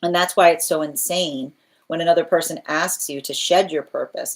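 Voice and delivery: ordinary talk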